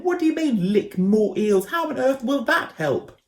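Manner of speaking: high, loud exasperated yell; baffled shout. A narrator's reading. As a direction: shout down in disbelief. Ben shouting down.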